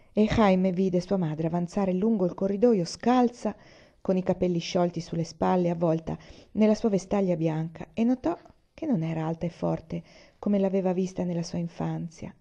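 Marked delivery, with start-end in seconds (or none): none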